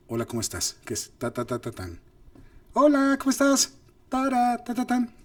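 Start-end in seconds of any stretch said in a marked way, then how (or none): 1.2-2.0 "ta, ta, ta, ta, tan" hummed out softly as even beats; syllable melody demo. Shown as a demonstration. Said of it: "yo podría decir". 2.7-3.7 much higher and louder, sing-song; high musical intonation. Shown as a demonstration. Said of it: "como notas musicales". 4.1-5.1 "tara, ta, ta, tan" far higher in pitch; high melody syllables. Shown as a demonstration. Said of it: "como notas musicales".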